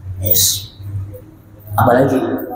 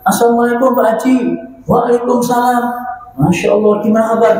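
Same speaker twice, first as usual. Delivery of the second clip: cheerful greeting; 7 semitones higher, faster than usual. Acted. Rich man greets Pak Haji.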